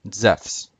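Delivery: Zephs said as one syllable, zefs; Greek pronunciation. Shown as a demonstration. Teacher shows viewers Zeus in Greek, Zephs said zefs. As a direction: say Zephs with short e and an fs ending. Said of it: "Zeus in Greek is spelled".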